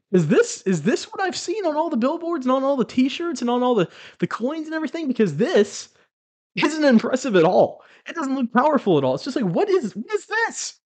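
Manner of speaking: high-pitched, incredulous scoffing; retold scornful incredulity, pitched high; mocking disbelief. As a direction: belittle it with baffled amusement. Retold scornful disbelief at tiny creature.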